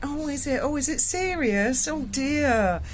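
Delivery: lightly hammed-up innocent surprise; playing dumb. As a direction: act the wide-eyed innocent for the debt collector. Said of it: "I played dumb and I went".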